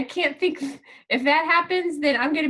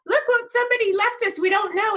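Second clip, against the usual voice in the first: put-on higher, eager kid voice; excited kids; 6 semitones higher than usual. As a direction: sound like thrilled little boys. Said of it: "boys come running in going".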